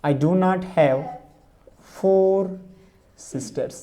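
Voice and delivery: stretched words, long pauses between; slow dictation. Shown as a demonstration. Teacher giving the advanced negative to students, slow. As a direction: dictate slowly so they can write. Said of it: "क्या होगा".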